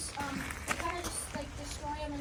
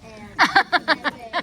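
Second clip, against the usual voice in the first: stretched slow and loud; drawn-out loud; 18 dB louder, much slower than usual. Acted.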